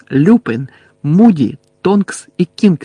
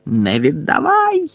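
high, drawn-out desperate yell; ревіння Невіла; 13 semitones higher, slower than usual. A narrator's reading. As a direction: cry out slowly, voice leaping high. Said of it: "ревів Невіл". (відчайдушний протяжний високий крик)

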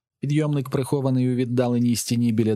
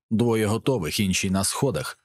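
plain reading voice

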